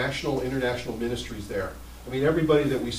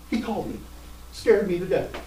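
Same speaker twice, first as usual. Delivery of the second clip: ordinary talk, nothing marked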